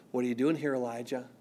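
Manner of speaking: hushed voice of God asking Elijah; still small voice. Acted. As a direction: ask gently as a still small voice. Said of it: "there's a still small voice".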